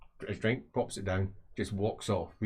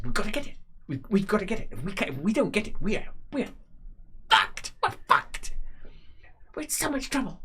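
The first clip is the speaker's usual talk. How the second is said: much higher, frantic, ending in a whisper; high panicked voice; 12 semitones higher than usual. Acted. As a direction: fret up high that they're doomed. Thug panicking about the money.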